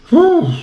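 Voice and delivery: high, loud whew; exasperated whew. Real, not acted. Commentator venting over a badly played game, exasperated whew. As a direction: let out a sharp, high-pitched "whew".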